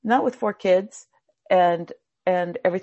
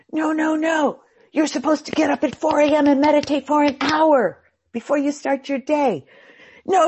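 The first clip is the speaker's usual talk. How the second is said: higher, louder know-it-all; bossy lecturer; 7 semitones higher, 5 dB louder than usual. Retold or imitated. Know-it-alls tell you how to meditate, bossy. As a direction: lecture them on the right way. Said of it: "everyone coming up to you going".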